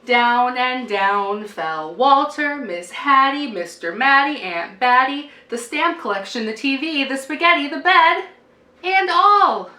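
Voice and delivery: rising cumulative chant; building refrain. A narrator's reading. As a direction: build the list to a big finish.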